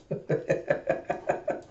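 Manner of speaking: quiet, voiceless snicker; stifled giggle in quick little bursts; snickering laugh. Retold or imitated. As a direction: snicker softly to yourself. Acted snicker within the story retelling.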